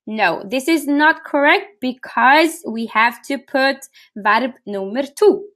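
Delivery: ordinary talk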